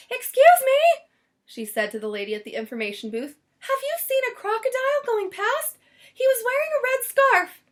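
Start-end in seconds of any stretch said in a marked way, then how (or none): 0.1-1.0 much higher, louder anxious lady's voice; frantic Missus Prim. A narrator's reading. Prim frantically searching for Lyle. 3.6-7.6 much higher, louder anxious lady's voice; frantic Missus Prim. A narrator's reading. Prim frantically searching for Lyle.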